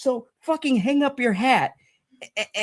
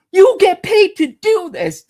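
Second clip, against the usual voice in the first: higher, louder indignant blast; indignant outburst; 5 semitones higher, 6 dB louder than usual. Real, not acted. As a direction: call the critics out hard. Ranting at critics.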